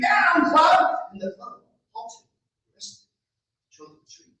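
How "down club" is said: much higher, louder cry; scream. Acted. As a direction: yell to stop the beating. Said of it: "Antonio screamed".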